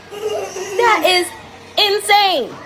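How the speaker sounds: high-pitched, emphatic outrage; appalled. Real, not acted. Mother reacting to what cartoons tell kids, appalled. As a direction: pitch it high and lean on insane.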